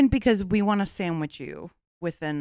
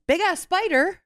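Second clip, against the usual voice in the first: high-pitched exclaimed title; exclamation point; 12 semitones higher, 4 dB louder than usual. Shown as a demonstration. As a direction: read out the title with a big exclamation mark. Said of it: "has an exclamation point at the end".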